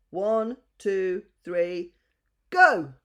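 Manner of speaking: counts up, then shouts go; a countdown bursting into a shouted go; counting out. A narrator's reading. The cock counts the animals in, then shouts.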